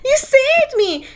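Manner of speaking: very high, drawn-out gushing; mock swooning heroine. Friend mocking heroine saved from the sea, swooning gratitude. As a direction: gush like a rescued damsel, hammed-up.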